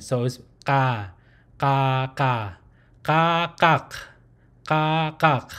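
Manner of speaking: open-mouthed "ka" and "kak" from the throat, no jaw movement; guttural ka drill. Shown as a demonstration. Teacher drilling the guttural "ka" with no jaw movement. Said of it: "It's a guttural sound".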